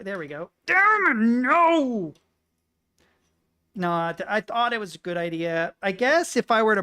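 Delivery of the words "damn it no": high, loud cry of frustration; sudden loud high exclamation; frustrated outburst. Real, not acted. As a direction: yelp it as the piece breaks loose. Piece falls off.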